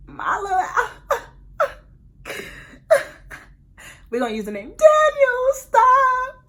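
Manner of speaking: high, loud, helpless laughter breaking the words; can't stop laughing. Real, not acted. Naming her boyfriend to the viewers while cracking up, real laughter.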